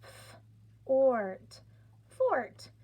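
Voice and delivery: "F, ort, fort" blended slowly, fort sung up; sounding out fort. Shown as a demonstration. Teacher blending sounds for the class, sounding it out. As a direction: stretch each sound then blend fort. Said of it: "do my sounds".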